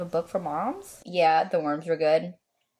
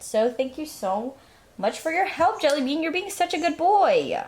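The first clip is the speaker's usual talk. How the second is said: higher, sing-song praise for a pet; gushing at a pet lizard, sing-song and higher; 6 semitones higher than usual. Real, not acted.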